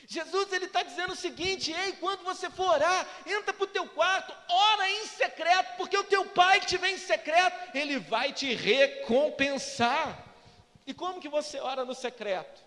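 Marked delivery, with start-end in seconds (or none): 1.7-10.3 raised, loud exhortation; forceful preaching. Acted. Jesus telling believers to pray in secret, forceful preaching. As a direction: build to a ringing, slowed last line.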